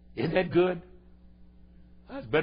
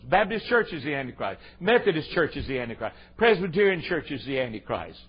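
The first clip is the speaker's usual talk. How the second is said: ordinary talk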